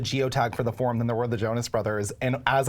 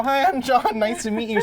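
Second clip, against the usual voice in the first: much higher, eager greeting; mock-perky hello, pitched way up; chirpy introduction; 9 semitones higher than usual. Fan introducing himself to the security guard, chirpy.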